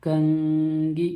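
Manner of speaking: कंगी very slow, n hidden in the nose; said slowly. Shown as a demonstration. Teacher slowly models कंगी for students, nasal n. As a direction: draw out कंगी with a nasal hum. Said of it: "कंगी को थोड़ा slow से बोलिए".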